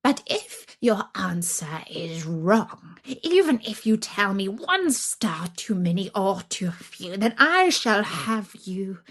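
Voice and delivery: a hungry witch savouring her threat, hushed then sharp. Acted. Baba Yaga sets the test.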